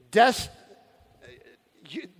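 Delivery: ordinary delivery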